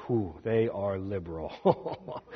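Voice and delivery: slow, low, quiet drawl with a chuckle; a chuckling aside, slowed right down; professor's wry remark. Retold or imitated. Old professor teasing about Presbyterians, slow and chuckling. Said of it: "one of my professors goes".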